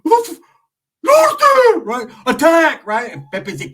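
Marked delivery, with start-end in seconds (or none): 0.0-1.9 very high, loud shout; gang challenge shout. Retold or imitated. Ricky shouting his gang challenge at the bulldogs. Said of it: "He's like". 2.2-2.9 very high, loud shout; gang challenge shout. Retold or imitated. Ricky shouting his gang challenge at the bulldogs.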